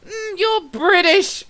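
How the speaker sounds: high, loud, slow mock British; put-on British. Reader deciding how a character sounds, playful British accent. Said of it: "one of those ones that I go".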